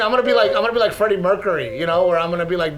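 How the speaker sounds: normal talking voice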